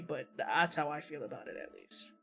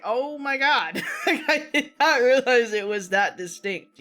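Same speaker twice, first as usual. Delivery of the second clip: high, loud, reenacted shock breaking into laughter; laughing astonishment; 7 semitones higher, 11 dB louder, slower than usual. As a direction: relive the shock, cracking up as you go. Reliving shock at being pinpointed, laughing.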